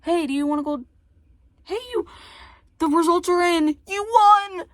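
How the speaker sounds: high, loud game show host; mock announcer. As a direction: announce the winner, hammed up.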